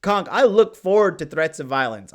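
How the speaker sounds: ordinary delivery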